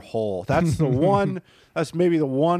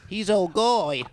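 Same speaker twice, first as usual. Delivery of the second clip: mock New Zealand accent; 7 semitones higher, slower than usual. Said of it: "I just do a New Zealand accent".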